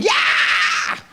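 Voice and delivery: harsh, drawn-out yell; crazed yell. Retold or imitated. Viewer watching Fox News, crazed harsh yell. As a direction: scream it like you're losing it. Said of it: "losing my mind, going".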